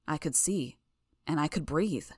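usual speaking voice

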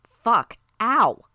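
loud high cry of pain; pained yelp. A narrator's reading. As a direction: yell it out, suddenly hurt. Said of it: "Regent gasped".

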